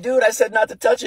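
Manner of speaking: acted incredulous reprimand; exasperated father. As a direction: rush out a frustrated parental protest. Exasperated father scolding in story.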